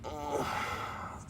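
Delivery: long, soft exasperated sigh; weary sigh. Acted. Villain mourning wasted money, weary sigh. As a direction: let out a sigh over the waste.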